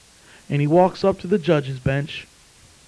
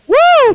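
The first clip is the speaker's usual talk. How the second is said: very high, loud praise whoop; real whoop of rejoicing; exultant whoop; 10 dB louder than usual. Real, not acted. Preacher rejoicing before the congregation, high whoop.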